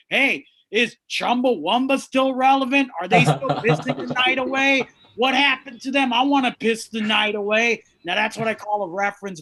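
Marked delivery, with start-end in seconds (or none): 0.1-7.8 put-on louder, higher dazed old geek asking; IT professor out of a mine shaft. Bearded comic imagining himself crawling out of a mine shaft, loud and dazed. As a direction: play a confused man just crawled out of a mine shaft. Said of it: "then he crawls out of the mine shaft and goes".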